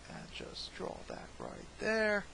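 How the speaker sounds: slow, drawn-out fake Australian accent; bad Australian accent. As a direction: badly fake an Australian accent, stretching the vowels. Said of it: "it's actually a really bad Australian accent".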